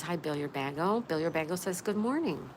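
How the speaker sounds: ordinary delivery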